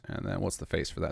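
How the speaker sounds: ordinary talk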